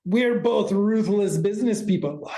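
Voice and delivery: cartoon Russian accent in the Boris and Natasha style; lightly done cartoon Russian accent. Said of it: "Don't know why I'm doing a Boris, a Natasha Boris accent".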